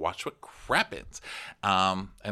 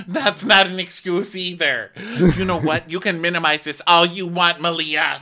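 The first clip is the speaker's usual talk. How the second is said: mock angry scolding; 9 semitones higher, 8 dB louder than usual. Captain scolding Malia.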